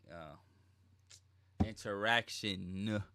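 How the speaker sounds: slow, stretched-out "interaction"; drawn-out word recovery. Real, not acted. Host finding a lost word, slow and drawn out. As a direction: draw the word out slowly as you find it. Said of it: "Lost my word there".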